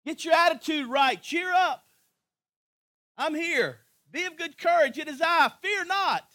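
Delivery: loud, high, rousing sermon climax; full-force preaching. Acted. Preacher rallying the congregation, loud and high. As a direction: hammer it home like a revival preacher.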